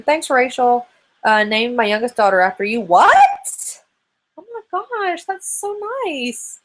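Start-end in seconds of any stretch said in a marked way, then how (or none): 2.8-6.6 stunned delight. Real, not acted. Reading that a fan named her daughter after her, high surprised squeal. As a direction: gasp it out, truly touched.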